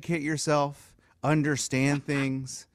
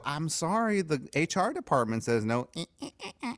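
mock prissy refusal; 7 dB quieter than usual. Mimicking wife refusing again.

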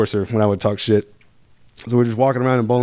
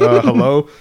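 puzzled; 3 semitones higher, 6 dB louder, slower than usual. Retold or imitated. Lady answers drunk man at jail buzzer.

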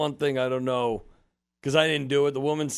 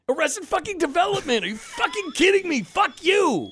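high, louder outraged rant building to a yell; furious rant; 12 semitones higher, 4 dB louder than usual. Retold or imitated. Retelling the supervisor's rant over the baby ticket, high and shouting. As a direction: scream the insult at the staff, high. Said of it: "it was like".

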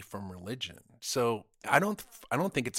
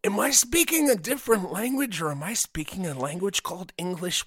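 higher, louder sarcastic exasperation; exasperated retort; 8 semitones higher, 6 dB louder than usual. Retold or imitated. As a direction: snark back in disbelief.

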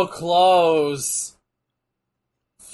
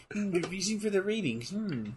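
much softer, musing read; imitating Shivani's reading; 11 dB quieter than usual. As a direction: murmur it like puzzling over a script. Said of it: "the way Shivani was reading that".

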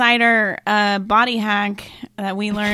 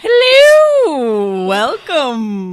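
excited greeting; 4 semitones higher, 9 dB louder, much slower than usual. Pretend. Greeting new patron playfully.

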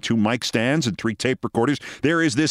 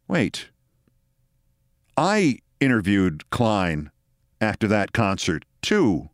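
low, slow and deliberate; measured words as it dawns on him; slow realization; 5 semitones lower, slower than usual. Retold or imitated. Confused rival realizing he did the interview too, slow low voice.

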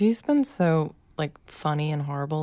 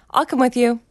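higher, louder eager little helper; mock child's persistent offer, pitched up; imitated insistent child; 5 semitones higher, 4 dB louder than usual. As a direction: insist like a small kid tagging along. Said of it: "so she keeps going".

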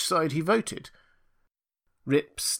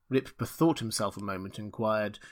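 plain reading voice

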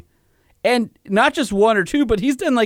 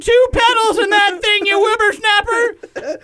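put-on high, slow old coot yelling; old prospector; 4 dB louder, slower than usual. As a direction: shake a cane at the kids. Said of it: "he shakes it at all the kids".

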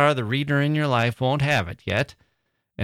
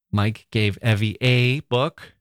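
a said as "ay", slow and pointed; stress on a; slower than usual. Shown as a demonstration. Host stresses the article a. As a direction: hit the little word a as "ay". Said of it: "emphasizing a single word".

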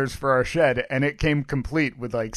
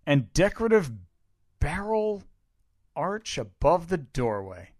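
sultry narrator; 5 semitones higher, 4 dB louder than usual. Pretend. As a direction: purr the listing like a seductive commercial. Reading ad copy aloud.

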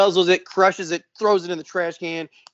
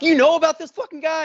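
incredulous, high and loud question; retold disbelief; 14 semitones higher, 5 dB louder than usual. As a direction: voice leaps up in disbelief. Retold disbelief at sheriff's admission.

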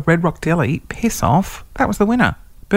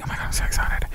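excited whisper; breathy excited whisper aside; 7 dB quieter, much faster than usual. Real, not acted. Mock-excited aside before final question.

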